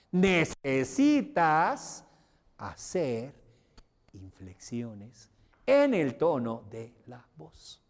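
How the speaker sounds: slow, each word stretched with big pitch swings; exaggerated inflections. Shown as a demonstration. Teacher models lively inflection for students. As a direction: lean hard into the tone changes. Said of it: "una demostración de cómo, si le meto inflexiones".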